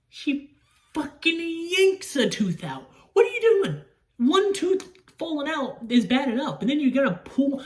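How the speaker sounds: high-pitched, horrified disbelief; appalled outburst. Real, not acted. Appalled retelling of gross scene.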